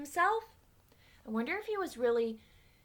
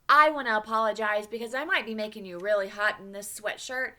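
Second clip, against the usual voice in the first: ordinary delivery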